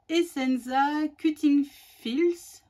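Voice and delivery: slow, careful attempt at English; French speaker's showy English accent. Real, not acted. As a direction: put on your best English accent, slowly. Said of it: "mon super accent anglais".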